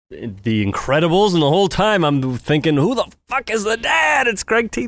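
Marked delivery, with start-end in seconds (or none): 2.8-4.9 much higher, incredulous outburst; exasperated recognition. Retold or imitated. Moviegoer suddenly placing the voice of the dad, exasperated. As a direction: blurt the inner thought in a high voice. Acting out cinema recognition of actor.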